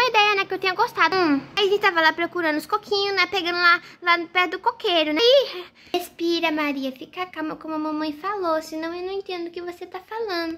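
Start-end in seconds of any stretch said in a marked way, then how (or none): 5.9-10.6 low, softened calming voice; soothing. Acted. Mother tells nervous daughter to breathe, soothing. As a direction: gently bring a flustered child down.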